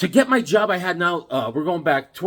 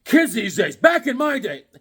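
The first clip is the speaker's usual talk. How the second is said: put-on cranky old-timer; 7 semitones higher, 4 dB louder than usual. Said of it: "gonna be like".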